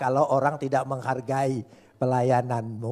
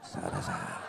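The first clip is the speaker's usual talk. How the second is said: much lower devilish growl; 7 dB quieter than usual. Acted. Preacher mocking flattery as satan's voice, lower and devilish. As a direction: drop into a mock demon voice.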